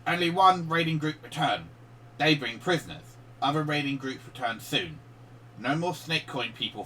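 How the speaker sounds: choppy, simple goblin talk reporting to its boss; broken common goblin. Acted. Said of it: "the goblin says in broken common".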